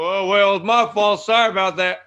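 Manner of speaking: loud, hammed-up Texas accent; bad Texas accent. Mocking elected officials shrugging off blame, bad Texas accent. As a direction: do a bad, blaring Texas twang. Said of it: "That was my bad Texas accent".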